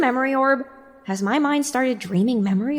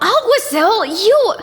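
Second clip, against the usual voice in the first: higher, louder furious yell; angry shout; 10 semitones higher, 6 dB louder, slower than usual. Acted. Sunrise yelling angrily at Alguacil. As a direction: lash out at him.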